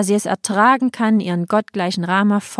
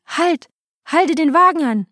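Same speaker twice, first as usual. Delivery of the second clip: much higher, loud command to stop; desperate shout; 9 semitones higher, 3 dB louder than usual. Acted. King shouts at the charioteer to stop, desperate shout. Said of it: "der König schrie".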